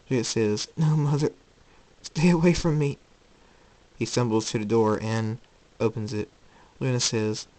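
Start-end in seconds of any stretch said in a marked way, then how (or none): 0.7-3.0 higher, urgent warning; distressed refusal. Acted. Jacob warning his mother off, urgent and upset. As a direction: push them away in rising distress.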